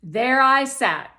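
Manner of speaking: ordinary delivery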